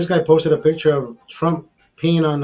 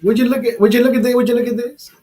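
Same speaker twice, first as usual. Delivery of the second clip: higher and louder, would you look at this; incredulous showing-off; excited disbelief; 7 semitones higher, 5 dB louder than usual. Real, not acted. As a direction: call the audience over, amazed. Showing viewers a surprising comment.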